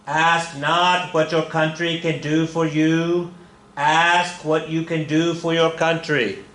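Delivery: put-on slow, lightly done Boston Kennedy accent. Teacher quoting Kennedy to class in slow mild Boston accent. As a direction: draw out the famous line in a mild Kennedy accent. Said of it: "I was about to do a Kennedy accent".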